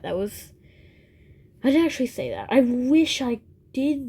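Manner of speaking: ordinary talk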